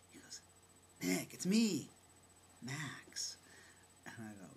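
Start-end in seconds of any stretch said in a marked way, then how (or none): 1.0-3.4 retold insistent hail, higher and louder. Acquaintance tries a second time to be recognised, insistent. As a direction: press to be recognised, acting it out.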